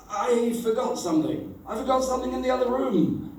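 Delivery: high, fast, fibbing; fake excuse. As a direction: rush out a made-up excuse. Fibbing to staff, rushed.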